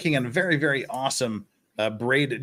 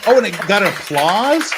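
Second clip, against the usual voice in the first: loud, high incredulous question; astonished; 7 semitones higher, 10 dB louder than usual. Real, not acted. Commentator reacting to courtroom applause, astonished. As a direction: blurt it out high and loud in disbelief.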